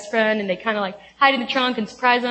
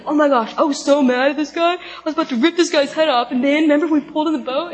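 higher, excited mock rant; animated guys swapping stories; 5 semitones higher, 4 dB louder than usual. Guys on the beach retelling their morning, excited higher-pitched banter. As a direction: gush it out like friends reliving the moment. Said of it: "I picture the guys on the beach being like".

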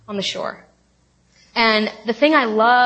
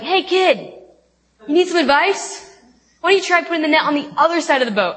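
higher-pitched dude calling out friendly advice; casual dude; 7 semitones higher, 4 dB louder than usual. Retold or imitated. Some dude on the shore advising fishermen, cheeky higher-pitched call. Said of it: "this some dude comes along the shore and is like".

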